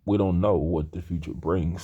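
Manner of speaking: ordinary delivery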